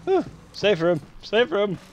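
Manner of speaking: gasping relief after the chase; being chased. Real, not acted. Reaches safe room breathless.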